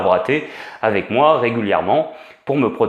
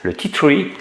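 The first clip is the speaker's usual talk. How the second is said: higher-pitched attempt at an English accent; 5 semitones higher, slower than usual. Shown as a demonstration. Presenter repeating the oil's name after self-teasing, playful English accent. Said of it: "J'adore mon accent anglais, pas mal".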